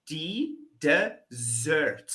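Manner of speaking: desert split slowly as de, zert; desert said very slowly, syllable by syllable. Shown as a demonstration. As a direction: stretch desert into two slow parts, de and zert. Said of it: "we're saying".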